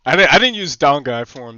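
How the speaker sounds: ordinary talk, nothing marked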